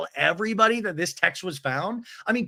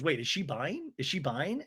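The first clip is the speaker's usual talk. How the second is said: hushed question; 8 dB quieter than usual. Retold or imitated. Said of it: "Emily goes".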